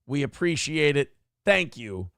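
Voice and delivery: ordinary delivery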